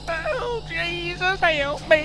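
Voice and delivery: very high, tearful wail; high-pitched crying for help; terrified plea. Acted. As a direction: beg for rescue in hammed-up panic. Victim pleads as creature attacks.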